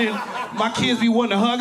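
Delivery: ordinary delivery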